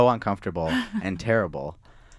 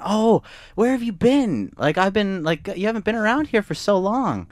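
bright, much higher voice imitating the barista; cafe worker; 12 semitones higher than usual. Cafe worker greeting a missing regular, in a high imitating voice. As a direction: squeal it like the surprised cafe worker. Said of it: "and she went".

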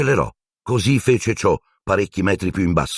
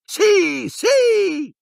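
shrieking. Acted. Ron cheering Harry, very high shrieks. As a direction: keep screaming with joy. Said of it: "strillò Ron".

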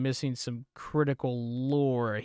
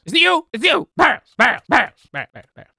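high, loud rapid-fire outbursts; mock accusing shout; 9 semitones higher, 11 dB louder than usual. Player accusing suspected demons in game, high mock shouting. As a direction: yell it in short bursts.

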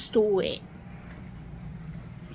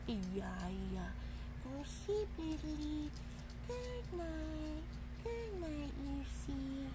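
very soft, hushed bedtime murmur; soft lullaby voice; 3 semitones lower, 19 dB quieter, slower than usual. Pretend. Putting the toys to bed, softly hushed. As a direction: lull them gently to sleep.

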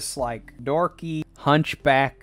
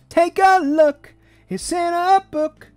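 Reading Rainbow host; 13 semitones higher, 4 dB louder, slower than usual. Retold or imitated. Quoting Reading Rainbow theme.

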